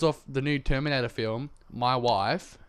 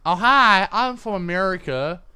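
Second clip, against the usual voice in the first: higher, louder put-on American greeting; mock American accent; 6 semitones higher, 7 dB louder than usual. Imagining prank-calling someone, high mock American. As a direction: fake being American on the phone. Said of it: "I can call someone and go".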